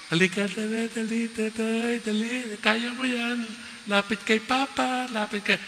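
put-on high, gentle coaxing voice to a toddler; coaxing father. Father calling his toddler to come, high and coaxing. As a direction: coax a wobbly child toward you.

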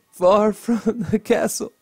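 giggly, breaking up mid-line; laughing through the lines; giggling. Real, not acted. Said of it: "I'm all giggly".

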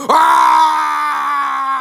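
high, loud, sustained scream; long scream. Acted. King thrown into another world, screaming. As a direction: scream all the way down. Sucked through a portal.

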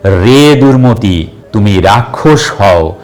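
ordinary delivery

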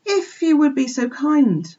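high, polite entreaty; pleading cry. A narrator's reading. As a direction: beg the flock earnestly and courteously. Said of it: "he cried".

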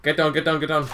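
urgent combat callout; urgent warning. Real, not acted. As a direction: shout a repeated warning. Urgent warning in game action.

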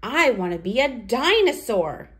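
ordinary delivery

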